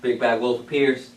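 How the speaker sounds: usual speaking voice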